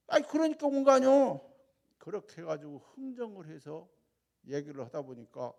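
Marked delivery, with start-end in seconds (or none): 0.1-1.4 Jeolla dialect. Retold or imitated. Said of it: "전라도 말에 솔찬히 잘합니다".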